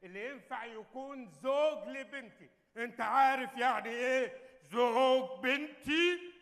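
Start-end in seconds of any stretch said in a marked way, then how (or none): 1.3-6.3 slow, weighty words spelled out; slow emphatic. Acted. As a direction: drag each word out for emphasis. King stresses his daughter's husband. (تشديد بطيء على كل كلمة)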